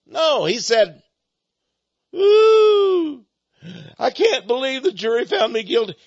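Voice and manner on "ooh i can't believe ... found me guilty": a high wailing ooh, then a whiny sob; mock wailing disbelief. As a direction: play the shocked crook crying over the verdict. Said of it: "He was shocked".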